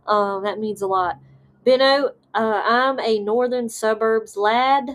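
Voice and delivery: ordinary delivery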